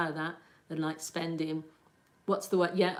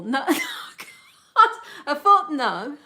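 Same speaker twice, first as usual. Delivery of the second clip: much higher and louder, laughing in disbelief; incredulous laughing; 9 semitones higher, 11 dB louder, much slower than usual. Real, not acted. Reacting to a viewer who thinks she's from Liverpool, laughing in disbelief. Viewer guesses her accent.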